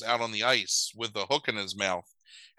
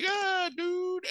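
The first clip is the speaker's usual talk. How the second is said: very high cartoon dog voice; imitated talking dog. Butterball answers the kid, silly high voice. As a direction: squeak it as a silly puppy.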